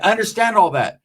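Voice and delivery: ordinary talk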